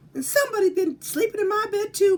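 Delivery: a startled mama bear calling out; mama bear crying out. Acted. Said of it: "Mama Bear cried".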